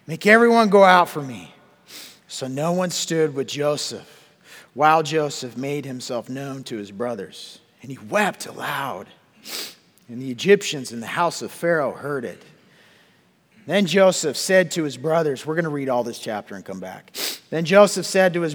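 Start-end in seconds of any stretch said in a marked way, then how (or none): 0.1-1.5 loud, higher outcry; crying out. Acted. Joseph ordering everyone out of the room, loud cry. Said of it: "And he cried out". 2.3-15.4 louder, steady Bible reading; scripture reading. Acted. Preacher reading Genesis aloud to the congregation, declaiming.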